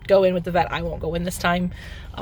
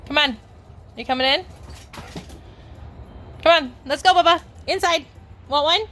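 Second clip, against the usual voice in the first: calling dogs in; 8 semitones higher than usual. Real, not acted. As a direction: sing out to get the dogs inside. Calling dogs inside, raised.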